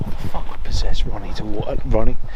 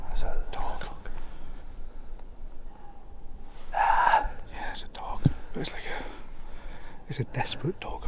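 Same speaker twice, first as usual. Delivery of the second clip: whispering; 9 dB quieter than usual. Real, not acted. Hearing a dog in dark.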